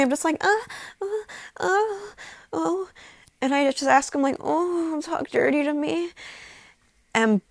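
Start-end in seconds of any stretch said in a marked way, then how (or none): 0.4-2.9 very high, short repeated moans; imitated weird moaning. Narrator showing how she sounded, high moaning. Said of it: "I sound really weird". 4.4-6.1 lightly acted plea, pitched higher; acted seductive plea. Narrator re-enacting a request to partner, higher-pitched plea.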